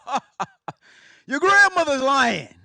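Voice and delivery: booming chuckle, brushing it off; hammed-up grandfather laugh; laughing grandpa. Grandpops voiced in story.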